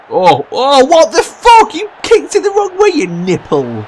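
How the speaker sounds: yelling high and loud in disbelief; furious shout. Real, not acted. Gamer yelling at his own striker, furious shout. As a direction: scream at the player who blew it.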